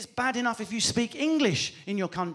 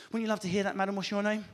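usual speaking voice

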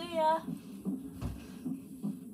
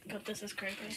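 hushed; 4 semitones lower, 12 dB quieter than usual. Real, not acted. Scared, hushed.

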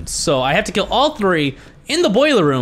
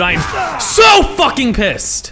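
loud, slow, higher, every word hammered; furious shout; 6 semitones higher, 3 dB louder, slower than usual. Real, not acted. Gamer vents at the game, slow furious shout. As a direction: spit out the rage.

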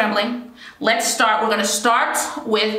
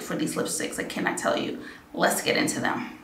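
whispering; 2 semitones lower, 9 dB quieter than usual. Real, not acted. Excited aside under breath.

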